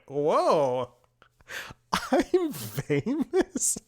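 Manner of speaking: high, drawn-out amazement with a laugh; mock starstruck awe. Recognized from an online review, mock starstruck awe. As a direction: stretch it out in squeaky, laughing wonder.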